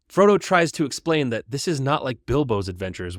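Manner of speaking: ordinary delivery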